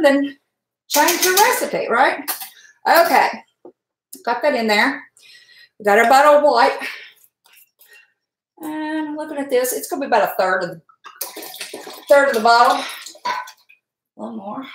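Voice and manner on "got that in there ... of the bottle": ordinary talk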